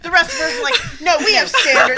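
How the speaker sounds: loud, high, through real laughter; laughing. Real, not acted. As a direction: crack up while protesting. Teasing friend amid group laughter.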